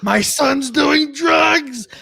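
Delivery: dramatic dad. Retold or imitated. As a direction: drag it out like a horrified dad. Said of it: "the dad's like".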